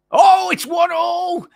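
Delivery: stretched, high, full-voice goal shout; long goal yell. Real, not acted. Goal scored, commentator erupts.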